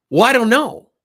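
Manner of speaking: high, loud, exasperated blurt; flustered employee. Retold or imitated. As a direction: toss it up high and loud, stumped.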